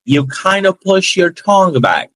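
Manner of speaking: American accent. Shown as a demonstration. Teacher showing students the English tongue position, slow American accent. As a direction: show the pulled-back tongue, slowly. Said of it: "quando a gente tá falando em inglês".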